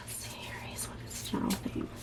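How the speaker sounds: faint whispered question to the cards; soft private whispered asking. Real, not acted. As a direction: breathe the question to Spirit. Asking Spirit over the cards.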